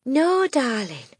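slow, gentle comforting; tender. Acted. Miss Honey voiced.

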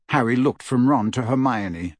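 ordinary delivery

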